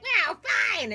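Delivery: rejected hag giving up in a huff; huffy hag. Acted. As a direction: storm off in a sulk. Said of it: "And she's like".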